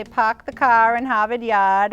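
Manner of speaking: Boston accent with the Rs dropped. Shown as a demonstration. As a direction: show off the old Boston accent. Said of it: "I have that accent".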